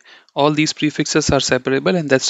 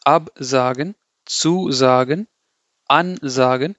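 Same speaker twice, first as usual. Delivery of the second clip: AB, ZU, AN stressed, then a short pause before sagen; slightly overdone prefix stress in Absagen, zusagen, ansagen; emphasized prefix with pause; slower than usual. Shown as a demonstration. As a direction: punch each prefix and hold a beat before sagen. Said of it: "I'm having a very sh-, short pause".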